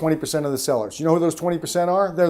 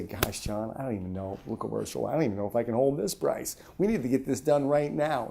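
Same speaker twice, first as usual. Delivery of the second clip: much softer, confiding, as if about to lose the price; pulled-back hush; 5 semitones lower, 11 dB quieter than usual. Shown as a demonstration. Seller hinting the price may vanish to John, much softer. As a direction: draw the customer in with a hushed, doubtful tone. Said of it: "What we do there is we pull back".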